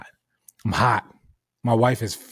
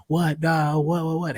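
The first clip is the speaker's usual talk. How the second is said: higher-pitched mock "what, what" taunt; mocking imitation; 5 semitones higher than usual. Mimicking the other driver looking at him, mocking imitation. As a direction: mimic her challenge in a raised voice.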